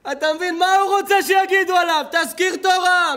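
preacher's booming cry; shouting preacher. Real, not acted. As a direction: thunder it at the congregation. Preacher mocks the sinner's wish.

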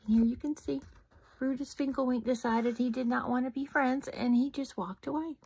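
plain reading voice

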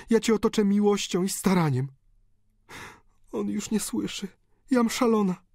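high, desperate daughter's plea over a dying father; countess pleading. A narrator's reading. Countess begging her dying father for blessing, desperate high plea. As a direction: beg him to come back, voice high and torn.

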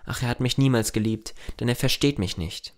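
usual speaking voice

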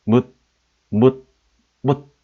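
묻 cut off short on a stopped final consonant; short clipped sound. Shown as a demonstration. Teacher demonstrating an old short sound, 묻 clipped short. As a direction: say 묻 as a quick, abrupt syllable. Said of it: "이런 짧은 소리입니다".

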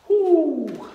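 loud high cheer; triumphant whoo. Real, not acted. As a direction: whoop after the set. Finishing a set of reps.

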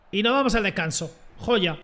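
ordinary talk

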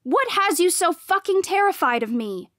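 high, loud, exasperated demand; yelled. A narrator's reading. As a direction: yell it at your rival. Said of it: "I yelled".

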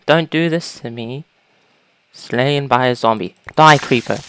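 ordinary delivery

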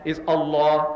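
"Allah" with a doubled l and a rounded final a; the Arabic "Allah", held l; "Allah" said "al-lo", long l; right Allah. Shown as a demonstration. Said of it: "It's not Allah".

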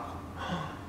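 put-on breathy surprised gasp for the kids; sharp gasp. Showing children the dog tipped the bath, gasping. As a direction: gasp as if shocked. Mock shock at page.

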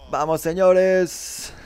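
slow, loud cheer stretching the last word; triumphant fan holler; celebratory shout. Real, not acted. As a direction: call it out to everyone, drawn long.

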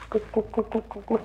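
soft high kuk kuk calls from far off; imitated bird call. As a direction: hoot it high and quiet like a distant bird. Menirukan suara burung dari atas. (tiruan suara burung yang tinggi dan pelan)

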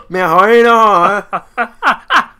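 high loud laugh-talk; laughing hard. Real, not acted. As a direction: squeeze words out through laughter. Cracking up laughing.

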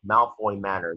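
ordinary talk

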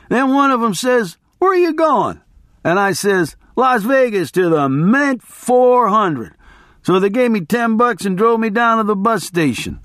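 high-pitched, worked-up barroom storyteller; boisterous guy telling his wild tale; rambling race fan. Acted. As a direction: hold forth to a crowd in a high voice. Voices rambling biker to crowd.

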